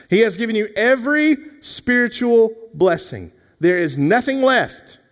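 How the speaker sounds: high, forceful pulpit delivery; impassioned preaching. Real, not acted. Preacher assuring the congregation they are fully blessed, impassioned. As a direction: drive each key word home, voice pitched high.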